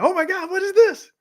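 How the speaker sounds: very high, hysterical disbelief; mock squeal of amazement; losing it. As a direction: re-enact fans freaking out at the spectacle. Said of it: "we lost it".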